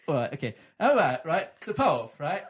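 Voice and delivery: ordinary talk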